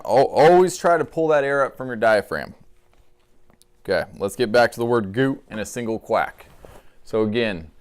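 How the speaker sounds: normal talking voice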